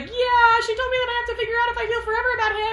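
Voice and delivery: very high, fast squeal of a reply; exasperated reply. Retold or imitated. Acted reply from Alex in retelling.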